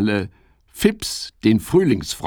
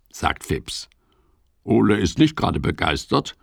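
plain reading voice

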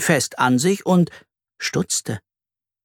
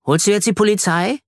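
put-on eager boy; 7 semitones higher than usual. A narrator's reading. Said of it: "fragte Ronny".

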